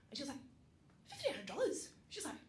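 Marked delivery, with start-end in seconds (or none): 1.1-1.9 loud, high, incredulous question; astonished retelling. Retold or imitated. Mother-in-law hearing the offer, astonished. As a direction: imitate an amazed relative, voice jumping right up.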